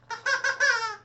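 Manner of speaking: very high squeaky puppet cackle; a bird puppet's shrill chuckle; high cartoon bird laugh; puppet squawk laugh.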